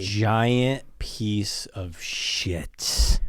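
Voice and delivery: very slow, drawn-out emphasis; heavy, deliberate condemnation; disgusted verdict. Real, not acted. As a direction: stretch every word out in disgust.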